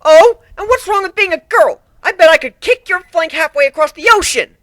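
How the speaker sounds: high, loud indignant outburst; mad. Acted. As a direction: blow up at the insult. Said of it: "Maria got mad".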